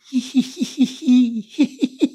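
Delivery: small high ihi giggle; quiet ee giggle. Shown as a demonstration. Teacher demonstrating the I laugh, quiet giggle. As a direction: giggle softly on ee. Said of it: "is a quiet laughter".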